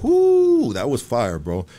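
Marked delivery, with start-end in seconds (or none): 0.0-0.7 long, loud, high-pitched whoo; impressed whoo. Real, not acted. Cohost reacts to a fire question with a high, loud whoo. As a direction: let out a big sustained whoop.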